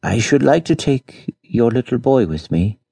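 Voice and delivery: asking a huge favour with the voice nearly breaking; broken, trembling voice. Acted. Wealthy lady begging peasants for their boy, trembling voice. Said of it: "in a broken, trembling voice".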